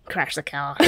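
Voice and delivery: low, quiet, sinister cat voice; a softly commanding evil cat; talking cat. Acted. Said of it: "The cat's like".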